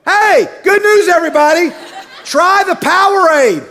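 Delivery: over-bright mock announcement to the crowd; mock cheerful announcer. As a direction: sell Powerade like a game-show host. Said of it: "As if she were gonna go".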